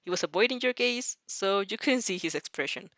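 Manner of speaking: ordinary delivery, nothing marked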